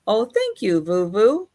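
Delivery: ordinary delivery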